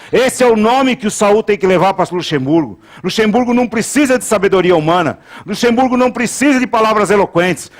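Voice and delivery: insistent pulpit cry, climbing in pitch; fervent sermon crescendo; forceful preaching. Real, not acted. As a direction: hammer each repeated phrase, building.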